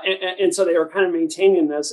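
ordinary talk

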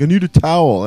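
high, loud mock demand; agitated insistence. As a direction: imitate a worked-up wrestler insisting. Retold wrestler insisting on a towel.

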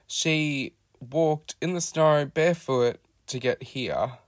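usual speaking voice

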